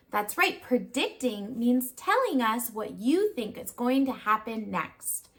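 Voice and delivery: usual speaking voice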